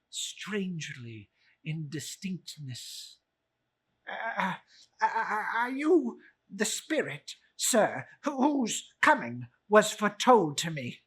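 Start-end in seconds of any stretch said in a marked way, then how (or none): 4.1-11.0 high, loud nervous miser; stammering old Scrooge. Acted. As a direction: Scrooge stammers before the spirit.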